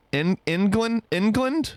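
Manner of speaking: England opening like end, high questioning rise. Shown as a demonstration. Trying a viewer's suggestion, England like end, incredulous rise. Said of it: "What if you said it more like end?".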